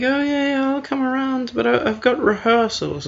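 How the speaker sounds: high-pitched, put-on excuse-making voice; high mock voice of someone stalling; mock Tinder match.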